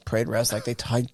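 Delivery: ordinary talk, nothing marked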